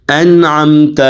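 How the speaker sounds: انعمت as 'an-amta', n closed with no vowel after it; correct held nun. Shown as a demonstration. Teacher demonstrates for students, انعمت with a firm silent nun. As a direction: hold the n firmly in انعمت before the ain. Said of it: "کو ذرا پکڑے".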